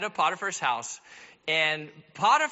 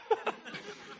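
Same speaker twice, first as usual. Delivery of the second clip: airy laugh; 13 semitones higher, 7 dB quieter than usual. Real, not acted. Laughing at own joke.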